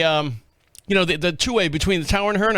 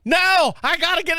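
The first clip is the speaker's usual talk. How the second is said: higher, shouted protest; frantic refusal; 9 semitones higher than usual. Retold or imitated. Tripping pilot yelling back at the copilot, frantic shout. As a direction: yell it back desperately.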